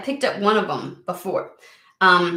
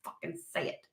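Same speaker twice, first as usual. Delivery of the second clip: whisper; 8 semitones higher, 16 dB quieter, faster than usual. Real, not acted. Frustrated at the cards' secrecy.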